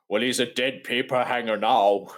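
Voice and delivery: slow mock-dramatic pronouncement; deadpan surgeon impression. Mocking the surgeon over his patient, slow pronouncement. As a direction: pronounce it slowly, putting on the surgeon. Acted retort of film surgeon.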